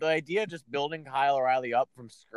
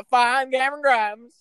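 hype; 8 semitones higher, 4 dB louder than usual. Acted. Hyping [name] playfully.

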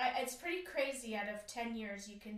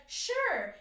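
sarcastic; 11 semitones higher, 9 dB louder than usual. Retold or imitated. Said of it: "I'd be like".

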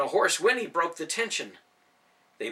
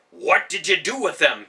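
loud accusing shout; angry shout; 3 semitones higher, 9 dB louder than usual. A narrator's reading. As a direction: yell it while swinging the staff. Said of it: "Ben shouted".

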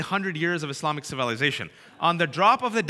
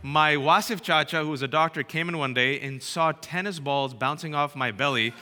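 ordinary talk, nothing marked

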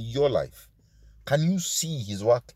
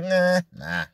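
mock dismissive refusal; 5 semitones higher than usual. Voicing a believer refusing God.

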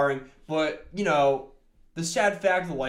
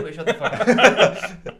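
mock outrage; 5 semitones higher, 8 dB louder, slower than usual. Mock outrage at country song.

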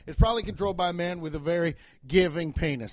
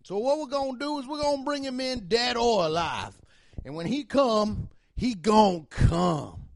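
Southern cop voice; 6 semitones higher than usual. Acted. Town cop briefing officers about a dangerous outlaw, Southern voice. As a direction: address the lawmen in a high Southern voice. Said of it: "I do that Southern voice".

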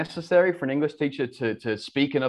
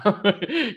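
high laugh at yourself; amused laugh; 9 semitones higher than usual. Real, not acted. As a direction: burst out laughing, pitch leaping up. Laughs at own realization.

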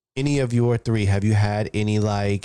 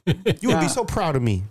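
lightly voiced, proud kid, higher pitched; mock child; 6 semitones higher than usual. Kid bragging to his dad about the punch, mock childish. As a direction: pretend to be a boy boasting to Dad.

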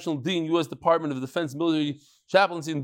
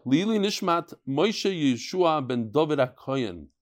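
ordinary talk

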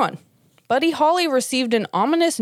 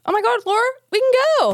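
excited; 14 semitones higher, much faster than usual. Real, not acted. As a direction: gush like you just got tickets. Excited about trip.

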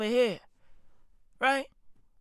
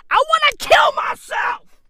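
much higher, louder outburst; exasperated shout; 8 dB louder than usual. Real, not acted. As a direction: blow up in fake despair. Ranting about TikTok chime.

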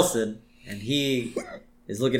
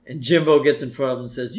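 ordinary delivery

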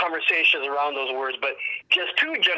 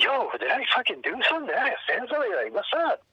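confused; 6 semitones higher, faster than usual. Retold or imitated. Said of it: "And I was like".

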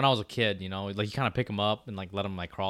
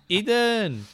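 put-on high, loud call of a small child; little sister calling; 11 semitones higher, 6 dB louder than usual. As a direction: call out like a kid under the door.

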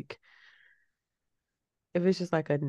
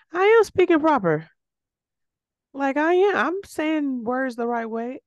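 much higher and louder, offended; indignant outburst; 9 semitones higher, 5 dB louder than usual. Real, not acted. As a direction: fire it back, stung by the correction. Indignant retort to mom's correction.

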